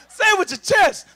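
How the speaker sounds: punchy loud coaching shout; said with chest. Shown as a demonstration. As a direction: belt it out from the chest. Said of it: "you ain't saying it with your chest".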